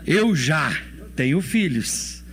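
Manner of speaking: ordinary talk, nothing marked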